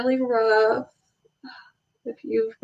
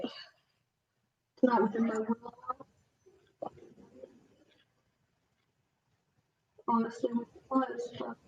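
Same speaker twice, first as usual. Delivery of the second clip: applying eyeliner; 14 dB quieter than usual. Real, not acted. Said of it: "You can't talk while doing eyeliner".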